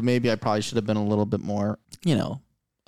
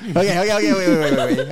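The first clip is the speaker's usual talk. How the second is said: higher, louder, rapid repeated plea; laughing interruption; 5 semitones higher, 5 dB louder, faster than usual. Real, not acted. Player stopping the table's joke, laughing and insistent. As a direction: halt the joking through your laughter.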